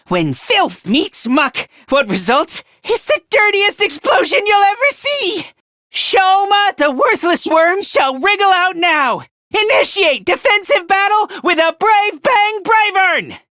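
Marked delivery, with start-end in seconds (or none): 1.9-13.4 high, ringing attack call; heroic battle cry. Acted. Worthless worm initiating battle with Bang Bravern, battle cry. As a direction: declaim it like launching a giant robot's attack.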